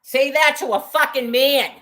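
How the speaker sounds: loud, high, furious challenge; angry challenge. Real, not acted. Woman challenging the harasser who insulted her, furious shout.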